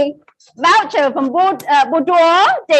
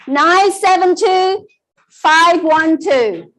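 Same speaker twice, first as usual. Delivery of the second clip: slow repeat; slower than usual. Shown as a demonstration. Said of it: "I repeat".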